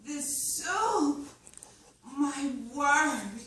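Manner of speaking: thrilled diner raving about the food; delighted. Acted. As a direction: swoon over the soup. Customer thrilled by soup.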